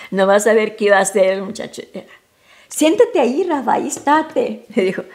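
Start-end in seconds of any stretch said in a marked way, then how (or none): 2.7-4.6 put-on a light high-pitched small boy, a bit louder, bossy; little boy's voice. Little son ordering his mother to sit, lightly high boy's voice.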